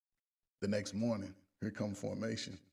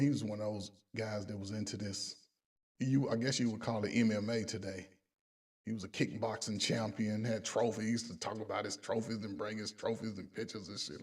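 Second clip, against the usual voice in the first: usual speaking voice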